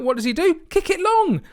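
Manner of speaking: rising high mock disbelief; mock incredulous. To viewers, mocking the keeper's choice, voice shooting up.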